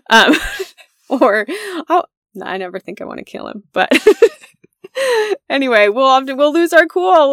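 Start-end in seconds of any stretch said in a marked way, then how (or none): none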